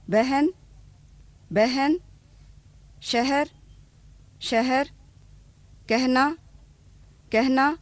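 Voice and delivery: बहन, शहर, कहना said with अ sounding like ए before ह. Shown as a demonstration. Teacher showing अ shifting toward ए before ह. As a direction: turn अ into ए before ह. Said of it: "ए की तरह हो जाता है".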